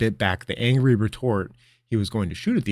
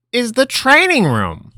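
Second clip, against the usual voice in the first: high, slow, grand wizard voice; grand old wizard; 11 dB louder, slower than usual. Acted. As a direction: present it like a showman. Linram presents the room grandly.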